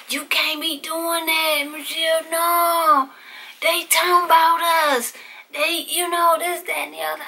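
put-on high, pleading older sister voice; upset sister. Sister begging Michelle to stop, high and upset.

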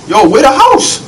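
ordinary talk, nothing marked

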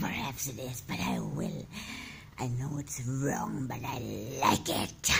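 deep demon confessing, then laughing; evil demon voice. Acted. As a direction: savor every word like a monster. Demon admits it likes killing.

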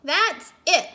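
fed-up mom. A narrator's reading. Mommy has had it with the dog, exasperated. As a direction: put your foot down as a fed-up mother. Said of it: "Mommy said".

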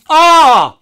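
real, high, loud sudden gasp-shout; surprised shout. Real, not acted. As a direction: yelp as something catches your eye. Spots a card in pack.

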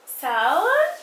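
"sound" sung out on a rising tune; drawn-out sound. Shown as a demonstration. Teacher modelling "sound" for children, drawn out and rising. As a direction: stretch "sound" and lift it at the end. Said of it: "we call it sound".

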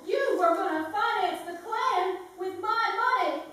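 angry accusation. Acted. Accusing him of using her money.

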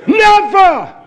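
high, loud single NEVER; shouted never. Real, not acted. Preacher to congregation, shouting Never. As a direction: slam the word Never down.

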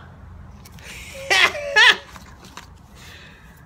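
high, loud delighted laugh; real shrieking laugh; burst of laughter. Real, not acted. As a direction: crack up out loud. Laughing at Grand Nana card.

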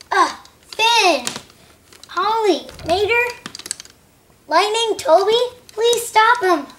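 high-pitched calling, name by name; pleading call for help. Acted. Percy calling his friends to stop Thomas, pleading call. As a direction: call out to each friend for rescue.

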